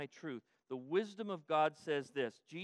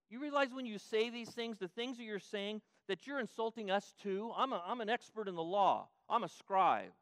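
offended lawyer; 6 semitones higher, 4 dB louder than usual. Acted. Preacher voices offended lawyer.

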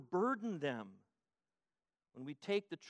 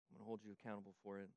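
quiet warning; 7 semitones lower, 11 dB quieter, much faster than usual. Shown as a demonstration. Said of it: "If you have this".

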